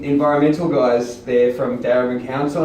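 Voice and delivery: ordinary delivery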